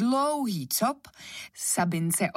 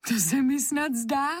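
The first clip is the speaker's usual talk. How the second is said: higher, lightly amused disbelief; 6 semitones higher than usual. A narrator's reading. Anička watches her slippers dodge her hand, amused. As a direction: say it as a tickled little girl. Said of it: "řekla Anička pobaveně".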